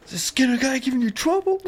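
very high, fast squeaky mob voice; mobster quote. Retold or imitated. Quoting the heavy guy from the Simpsons, very high fast voice. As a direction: rush it high like a cartoon heavy. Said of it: "the heavy guy or whatever is like".

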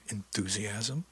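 "enthusiasm" drawn out slowly, rising at the end; stress on enthusiasm. A narrator's reading. She gives him orders, stress on "enthusiasm". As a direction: linger pointedly on "enthusiasm". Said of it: "a special emphasis on that last word".

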